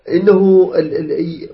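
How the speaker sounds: usual speaking voice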